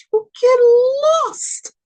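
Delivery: high, loud and slow, like a dire prophecy; ominous warning. Acted. Reader warns a lost Pisces, ominous and slow. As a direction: draw it out as a warning of doom.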